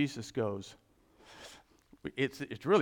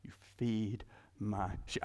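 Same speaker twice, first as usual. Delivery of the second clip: whispered charge; 8 semitones lower, 8 dB quieter than usual. Retold or imitated. Jesus' charge repeated.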